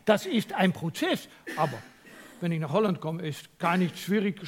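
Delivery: ordinary talk, nothing marked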